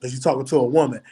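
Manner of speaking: usual speaking voice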